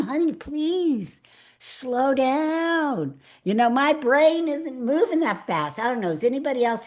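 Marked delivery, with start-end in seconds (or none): 0.0-5.8 slow, high, drawn-out "Honey, please slow down"; pleading with fast talker. Retold or imitated. Speaker asking a fast-talking clerk to slow down, slowly and sweetly. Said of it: "I have to tell them".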